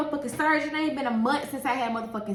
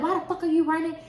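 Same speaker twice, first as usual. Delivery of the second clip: angry demand, pitched up; retold anger; 5 semitones higher, faster than usual. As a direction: imitate a mad, higher-pitched challenge. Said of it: "I was so fucking mad".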